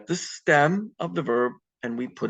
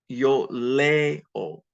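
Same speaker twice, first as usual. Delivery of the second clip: Yo leo with a strong, held "le"; second-last syllable stressed. Shown as a demonstration. Teacher demonstrating emphasis for students, stressing leo's first syllable. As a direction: stress the "le" of leo, light final o. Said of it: "it goes on the second last syllable".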